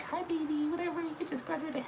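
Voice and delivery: high, sweet mom voice on the phone. Retold or imitated. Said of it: "You know, like".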